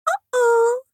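very high, exaggeratedly childish sing-song. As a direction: tease like an overdone little girl. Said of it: "une voix au perché, exagérément enfantine".

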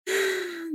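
soft, airy sigh, half resigned, half amused; a light rising sigh of fond resignation. A narrator's reading. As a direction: sigh at his silly friends. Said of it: "Rémus poussa un soupir, à la fois résigné et amusé".

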